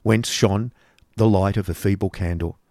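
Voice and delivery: ordinary delivery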